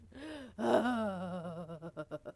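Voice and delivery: loud mock gasp; loud hammed-up gasp; fake gasp. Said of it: "be like".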